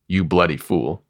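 ordinary delivery, nothing marked

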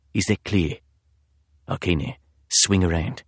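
put-on low, soft husky British accent; quiet British menace, husky. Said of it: "a man's husky voice".

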